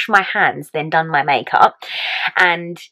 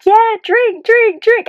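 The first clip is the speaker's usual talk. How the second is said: high-pitched mock drunk cheer; egging on; slower than usual. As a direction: imitate a drunk partner chanting excitedly. Drunk partner urging her to drink.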